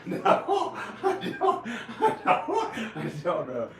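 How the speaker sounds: high, loud words dragged through laughter; real breathless giggle fit; laughing. Real, not acted. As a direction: try to speak while cracking up. Cracks up after put-on voice.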